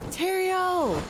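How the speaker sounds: high, drawn-out cry of a name; calling out. Acted. Player's character calls to snatched ally, calling out. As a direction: cry out to someone being carried away.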